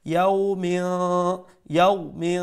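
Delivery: ইয়াউমি with the final n held as a hum; ikhfa recitation. Shown as a demonstration. Teacher demonstrating ikhfa, held nasal ending on ইয়াউমি. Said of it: "এই তানবিন রে ইখফা করে".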